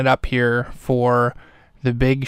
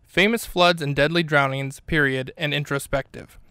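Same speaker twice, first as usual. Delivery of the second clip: measured, softer podcast sponsor read; mock ad read; 2 semitones higher, 5 dB quieter than usual. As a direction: read the silly book ad in earnest, lilting announcer style.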